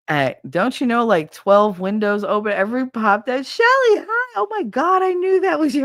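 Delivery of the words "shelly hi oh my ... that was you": loud, very high-pitched greeting; excited calling out. Retold or imitated. Neighbors spotting her after the screech, excited shouting. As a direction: imitate neighbors squealing hello from windows.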